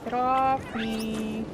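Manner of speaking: loud, very slow drawn-out command; yelling at the dog. Real, not acted. Streamer yelling at dog on her lap, loud. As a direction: shove the dog off with your voice.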